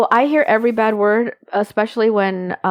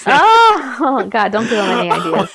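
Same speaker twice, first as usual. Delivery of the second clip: laughing protest. Real, not acted. Co-host reacting to the spin-off joke, laughing. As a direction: protest through real laughter.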